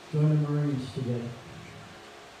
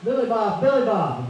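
hollering again; 6 semitones higher, 7 dB louder than usual. Retold or imitated. Wounded soldier calling his friend yet again, loud pleading. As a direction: cry out his name once more. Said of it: "Albert hollered again".

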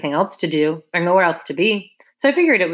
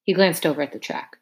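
usual speaking voice

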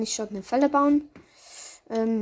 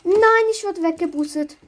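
dismayed cry; 6 semitones higher, 6 dB louder than usual. Real, not acted. Knocked away in the game.

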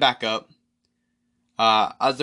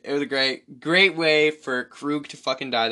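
ordinary talk, nothing marked

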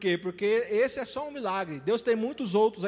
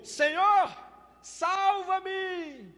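high desperate cry to the Lord; Peter's cry for help; 11 semitones higher, much slower than usual. Retold or imitated. Peter sinking cries to the Lord, high desperate plea. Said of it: "E Pedro gritou".

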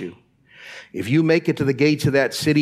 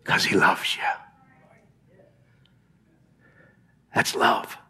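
soft whisper of love; whispered tenderness; 7 semitones lower, 4 dB quieter than usual. Real, not acted. Preacher whispering God's love to the congregation. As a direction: breathe it to them gently.

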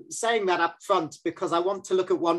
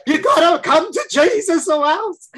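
high, loud hammed-up zealot parent; mock preachy zealot; 12 semitones higher, 9 dB louder than usual. Parent mocking a preachy zealot to kids, hammed-up loud. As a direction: ham up a pushy religious wacko.

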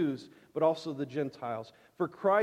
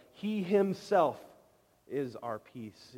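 slow, stressing "himself" and "is"; emphatic; 2 semitones lower, slower than usual. Shown as a demonstration. Preacher showing the congregation how emphatic the verse is, slow and stressed. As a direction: let "He himself is our peace" land slowly. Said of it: "it's powerfully emphatic".

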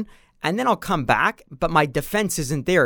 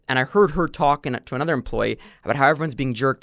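ordinary talk, nothing marked

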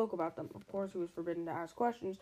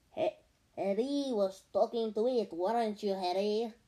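put-on French accent. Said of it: "does Piers really have a French accent".

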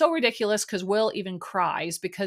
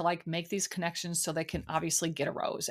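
ordinary talk

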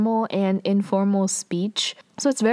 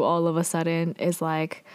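usual speaking voice

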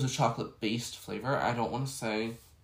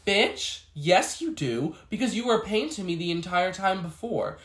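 indignant rant; 6 semitones higher, 4 dB louder than usual. Real, not acted. Ranting at rude customer.

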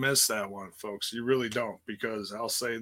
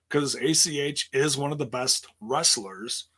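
normal talking voice

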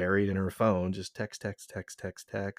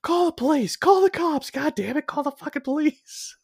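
high-pitched mock yell for help; retold yelling; 3 dB louder than usual. Cousin to the lady who walked by, urgent yelling. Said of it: "I'm yelling at the lady".

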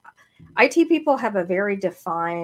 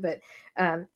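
normal talking voice

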